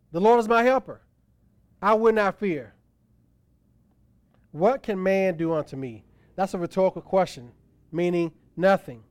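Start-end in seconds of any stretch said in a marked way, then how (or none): 0.1-6.0 louder and higher, proclaiming each line; emphatic declaration. Shown as a demonstration. Preacher repeating the verse to his congregation, booming proclamation. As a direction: ring it out like a battle cry of faith.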